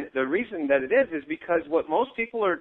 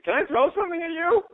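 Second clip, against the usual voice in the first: exasperated retort; 11 semitones higher than usual. Retold or imitated. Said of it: "You're like".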